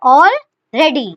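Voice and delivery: Already stretched out very slowly while writing it; drawn-out slow word. Shown as a demonstration. Teacher slowly drawing out Already for the students. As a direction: draw out Already slowly as you trace its outline. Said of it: "Already को कैसे बनाएंगे?".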